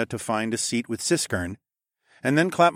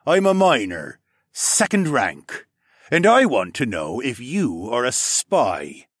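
suspicious miner; 3 semitones higher, 5 dB louder, slower than usual. Acted. Miner Serik demanding answers from Alex, slow and suspicious. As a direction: lean on each word, distrustful.

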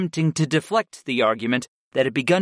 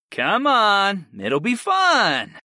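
higher, stretched-out cajoling; insistent coaxing; 8 semitones higher, slower than usual. A narrator's reading. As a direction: coax him along, drawing out the end. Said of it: "I insisted".

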